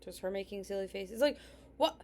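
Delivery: normal talking voice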